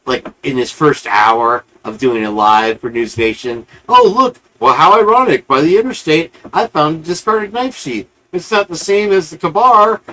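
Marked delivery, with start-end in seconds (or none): 3.9-10.0 sarcastic impression of a grandstanding TV investigator; mocking the investigator. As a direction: ham up the fake-surprised investigator finding his prop. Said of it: "he did this whole".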